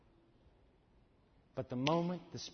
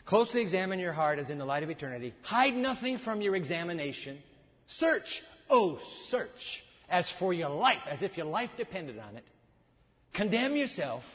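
loud, fast, higher read of the quotation; preaching read; 4 semitones higher, 6 dB louder than usual. Acted. Preacher reading the quotation aloud with fire. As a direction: preach the quote like a charge.

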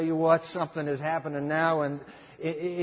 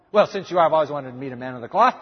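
hammed-up deflection; 7 dB louder, much faster than usual. Woman deflects, voiced loud fast.

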